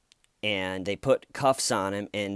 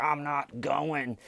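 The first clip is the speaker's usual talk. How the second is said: slow, higher, sulky refusal; stubborn drunk; 5 semitones higher, slower than usual. Retold or imitated. Drunk driver wedged in his seat, sulky refusal. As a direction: dig in like a stubborn child. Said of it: "and he's like".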